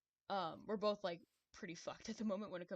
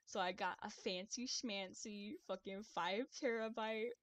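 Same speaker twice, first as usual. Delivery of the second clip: put-on Valley Girl accent; 4 semitones higher than usual. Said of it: "turn on the Valley Girl accent".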